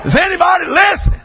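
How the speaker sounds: slow, high pulpit holler; fired-up preaching. Real, not acted. Preacher contrasting new pews with crude beginnings, fired-up preaching. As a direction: drive each phrase home to the congregation.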